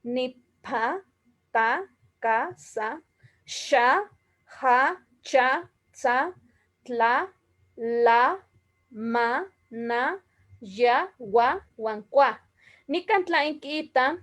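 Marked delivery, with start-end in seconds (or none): 1.5-12.4 each Nahuatl syllable said slowly and clearly, ta, ca, sa, xa, ja, cha, tla, la; syllables one by one. Shown as a demonstration. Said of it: "ni tlacuiloli".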